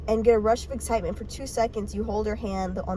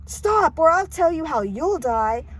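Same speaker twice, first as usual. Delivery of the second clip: high, loud acted warning; heated, raised-voice comeback; angry threat; 7 semitones higher, 6 dB louder, slower than usual. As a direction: cut him off with a loud threat. Narrator's imagined angry comeback to seer.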